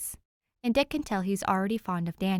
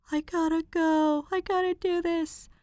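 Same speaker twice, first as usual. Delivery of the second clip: mock frantic self; 9 semitones higher, 4 dB louder than usual. Reader quoting her own panic about the deadline, mock frantic. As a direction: play your panicked past self. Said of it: "I'm a big procrastinator".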